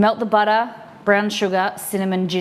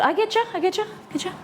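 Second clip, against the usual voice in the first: playful high voice; 10 semitones higher, 4 dB quieter, much faster than usual. Pretend.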